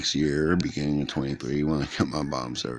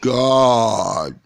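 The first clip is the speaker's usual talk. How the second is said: slow, drawn-out oh my God, louder; touched gasp; 4 semitones higher, 8 dB louder, much slower than usual. Retold or imitated. Answering the boy who asked was I nice, touched. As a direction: melt at the sweetness, stretching it out. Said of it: "And I'm like".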